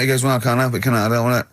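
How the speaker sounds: ordinary talk, nothing marked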